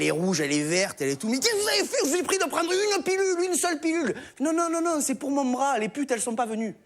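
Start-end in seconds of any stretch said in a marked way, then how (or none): 1.3-4.1 high shrieking alarm; shocked pharmacist. Acted. Pharmacist shrieks at the customer in shock. 4.4-6.8 high, pleading protest; customer punchline. Acted. Customer answers the pharmacist in a high protest.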